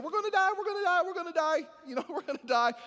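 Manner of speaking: high, fast hammed-up panic; mock panic. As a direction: squeal it like panicking disciples, laughing. Pastor mocks panicking disciples.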